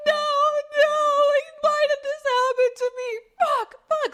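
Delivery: imitated high-pitched, loud wailing sobs; hysterical crying. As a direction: wail it as a hammed-up bathroom meltdown. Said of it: "hysterically crying in the bathroom".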